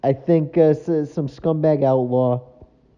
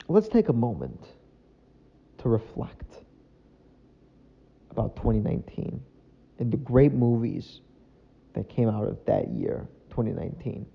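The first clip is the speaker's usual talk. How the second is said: mock solemn; 2 semitones lower, 6 dB quieter than usual. Inviting viewers to reflect on 2019 movies, mock solemn. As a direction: pretend to open a solemn tribute.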